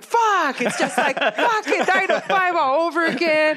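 high-pitched, comically fed-up outburst; hammed-up traffic despair; mock exasperation. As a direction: whine it way up high, on the edge of laughing. Acts out hitting traffic, exasperated.

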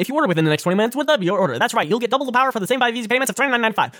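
fast, high infomercial disclaimer read. Pretend. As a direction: rattle off the fine print like a pitchman. Here's how to order.

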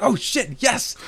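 high-pitched excited exclamation; retold elation. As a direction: re-enact jumping at good news, pitch way up. Retells joy at wallet being found.

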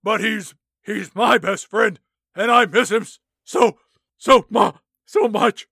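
loud, gruff, grief-stricken Batman. Acted. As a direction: growl it like a grieving Batman. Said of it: "Batman chimes in".

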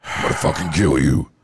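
low, rough threatening voice; mock death threat, dropped low; menacing prank caller. Prank caller to his ex-girl, low mock menace. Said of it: "I would call my ex-girl and go".

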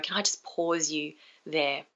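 soft and slow, gently; gentle. Shown as a demonstration. Coach showing a gentle interruption, soft and slow. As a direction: ease it out softly, like pressing a button. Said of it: "gently pushing down on a button".

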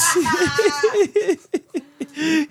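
squealing high laugh; high burst of laughter. Real, not acted. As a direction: break into a shrill-pitched cackle. Laughing at the periods joke.

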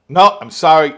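loud, firm voice of the experimenter; stern experimenter. Retold or imitated. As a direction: cut him off loudly as the man in the lab coat. Experimenter voiced telling answer wrong.

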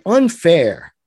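protesting to voters, a loud cry of unfair. Pretend. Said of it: "crying out".

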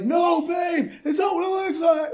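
high shouted pleading; frantic begging from the back seat; panicked pleading. Retold or imitated. Cuffed man begging his girlfriend from the back seat, panicked shout. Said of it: "I'm in the back seat like".

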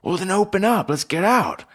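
high, loud plea to flee; urgent alarm. Acted. Urging escape from a robbery.

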